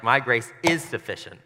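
"is" punched loud; heavy emphasis on "is"; stress on is. Shown as a demonstration. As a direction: hit the word "is" hard. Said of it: "or is it like".

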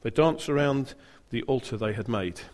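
ordinary talk, nothing marked

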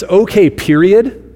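slow, pointed mock-upset okay; upset texting tone. Shown as a demonstration. Preacher demonstrating an upset spouse's text, slow and pointed. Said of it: "then I know the person's upset".

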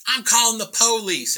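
loud, high-pitched Southern threat; Southern accent. Retold or imitated. Truck owner threatens the painter with police, loud Southern accent. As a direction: holler it in a mock Southern accent. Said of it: "she has a southern accent".

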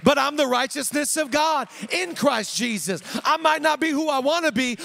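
high, loud, driving pulpit delivery; impassioned, shouted sermon climax; full-force preaching. Real, not acted. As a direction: preach it out to rouse the congregation.

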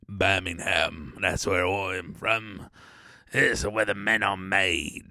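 low, slow Birmingham voice; mock Birmingham drawl; put-on Birmingham accent. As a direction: answer slowly and low as a Birmingham man. Answers as man from Birmingham.

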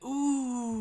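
high, drawn-out ooh; awed kids' ooh. Acted. Kids seeing a glowing sword, high awed ooh. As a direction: coo it like impressed children. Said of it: "They're like".

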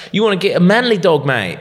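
put-on Australian accent, taunting about a small dog; Australian bloke. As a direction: needle him like a laddish Aussie. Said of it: "he's fucking Australian".

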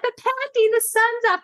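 put-on high little-girl voice protesting; imitating her daughter. Mother imitating Kiki objecting to panda, high childlike voice. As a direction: mimic a small child objecting. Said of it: "And she's like".

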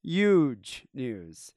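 slow, building hype to a loud huge; hyped announcement. Real, not acted. Host teasing big news to listeners, drawn out and excited. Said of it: "hyping the big news".

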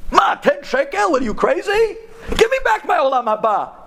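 high, frantic cabbie with a disbelieving laugh; outraged and incredulous, pitch way up; worked up. Acted. Taxi driver demanding his Olam Haba back, frantic high voice. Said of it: "The Nahag starts getting all worked up".

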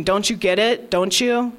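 ordinary delivery, nothing marked

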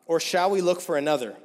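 ordinary talk, nothing marked

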